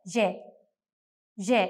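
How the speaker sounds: ജെ as "zheh", like the middle of "vision"; soft zh at the start of ജെ; Turkish J. Shown as a demonstration. Teacher modelling the letter after comparing vision, soft zh. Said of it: "വിഷൻ എന്ന് പറയുന്നത് പോലെ".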